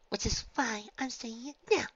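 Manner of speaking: Fluttershy, firm. A narrator's reading. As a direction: stand your ground in a high, girlish voice. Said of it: "Fluttershy looked at them firmly".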